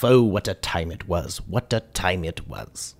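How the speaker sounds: ordinary talk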